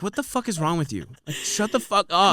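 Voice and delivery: exasperated, hammed-up snap at a pest; mock angry outburst. His retold angry reply.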